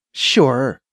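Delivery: ordinary delivery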